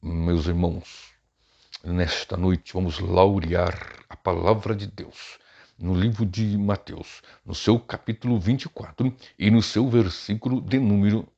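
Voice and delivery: very slow, drawn-out pompous pulpit oratory; theatrical preacher parody. Shown as a demonstration. Teacher showing preachers the theatrics to avoid, pompous and theatrical. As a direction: intone every word with mock solemnity. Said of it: "não fique naquele negócio".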